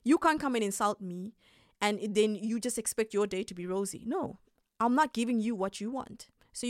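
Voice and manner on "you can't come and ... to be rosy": normal talking voice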